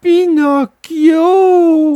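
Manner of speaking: high, slow, drawn-out whine; the fox begs pitifully; the fox whines at Pinocchio for charity; whining fox. A narrator's reading. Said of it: "d'une voix pleurnicharde".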